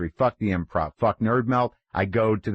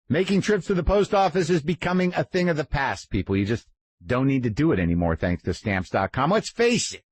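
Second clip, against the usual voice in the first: upbeat, higher-pitched sponsor read; bright host ad read, higher than his chat; 5 semitones higher than usual. Acted. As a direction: sell it like a friendly radio spot. Reads a stamps.com ad.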